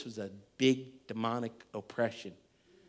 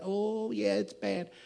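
higher, put-on little lady voice; sweet little lady; 7 semitones higher, slower than usual. Troubled woman confiding in her pastor, high sweet voice. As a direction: imitate a sweet lady, pitched up. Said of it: "the sweet little lady's voice".

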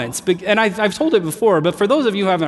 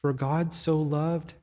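soft, slow, gentle hint; quietly feed him the line; kind prompt; 9 dB quieter, slower than usual. Retold or imitated. Daughter kindly prompting the flustered pastor, softly. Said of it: "in her very kind way".

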